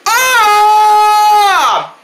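long, high, loud scream; acted scream. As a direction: scream as if sent flying. Elephant gets kicked away.

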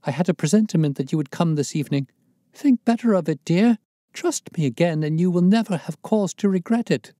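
high, loud pleading woman's voice; wife begging her husband in the dark; woman's entreaty. A narrator's reading. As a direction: implore him tenderly but desperately. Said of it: "attitude of entreaty".

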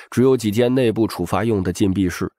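plain reading voice

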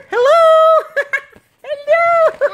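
high, loud giggly call; laughing call. Real, not acted. Calling into the toy.

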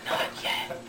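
mysterious whisper with long gaps; secretive whisper. Acted. Son refusing dad's demand, whispering mysteriously. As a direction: whisper it, pausing between the words.

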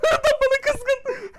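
high, loud, laughing through the words; laughing hard. Real, not acted. Laughing at an enemy player who is angry at him. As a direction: crack up at it.

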